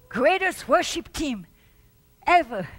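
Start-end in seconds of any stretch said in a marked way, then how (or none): none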